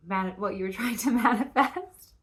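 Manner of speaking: can't hold back a laugh mid-sentence. Real, not acted. Tarot reader laughing at the card's twist while telling it. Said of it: "I'm laughing".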